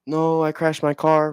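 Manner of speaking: high, panicked mock wail; imitating someone freaking out over a wrecked car. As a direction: play a person losing it over a car crash. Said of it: "emotional and you're freaking out".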